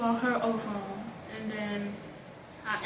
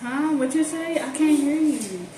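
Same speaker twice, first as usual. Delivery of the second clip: faking bad connection; 4 semitones higher, 5 dB louder than usual. Girl pretending to grandma the internet failed, faking bad reception. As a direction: call out as if the line is dropping. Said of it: "like, the internet wasn't working".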